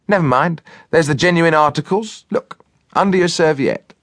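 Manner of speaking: high-pitched cook voice; chatty cook. A narrator's reading. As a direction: briskly make it up to him after the prank. Cook voiced consoling butler after prank.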